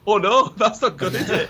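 put-on boy watching his mate fall, laughing nervously. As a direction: giggle at the disaster. Friend falls from tree.

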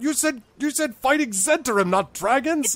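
high, loud panicked protest. Acted. Goblins panicking about a dragon, high and loud. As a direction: freak out in alarm. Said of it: "they're like".

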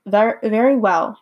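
Very well said slowly with a falling Very after a stumble; slow careful reread of very well. Acted. Reader restarts Oz's line.

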